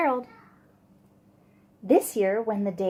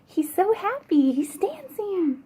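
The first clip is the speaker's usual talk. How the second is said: higher, beaming aside; delighted; 8 semitones higher than usual. Real, not acted. Reader telling children Gerald is happy, delighted.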